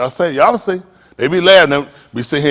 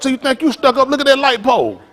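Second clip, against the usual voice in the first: hollered comeback; 11 semitones higher, 4 dB louder than usual. Acted. Repeats hollered line to stuck-up girl.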